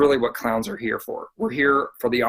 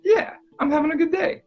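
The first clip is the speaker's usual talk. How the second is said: high, bright mildly happy chatter; cheerful five-happy voice. Shown as a demonstration. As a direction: act contentedly happy. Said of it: "You're like".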